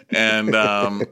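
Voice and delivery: ordinary talk, nothing marked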